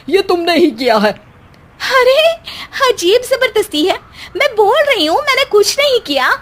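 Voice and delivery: bickering in-laws accusing each other in high whines; whiny accusation. Acted. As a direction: protest shrilly that you did nothing. Morning quarrel, accusations traded.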